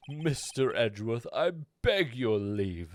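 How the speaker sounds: normal talking voice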